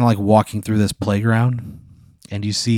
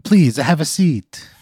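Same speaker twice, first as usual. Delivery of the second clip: slow, higher bad Italian accent; 6 semitones higher, slower than usual. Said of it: "a really bad Italian accent".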